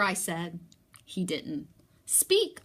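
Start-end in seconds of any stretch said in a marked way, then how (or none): 2.0-2.6 sharp high command to a pet. Acted. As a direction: bark the order up high. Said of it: "I commanded".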